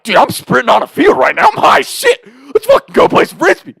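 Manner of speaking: high, hyped reenactment; mock excited yell; hyped-up exclamation. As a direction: act out being high and pumped. Acted hyped self before running out.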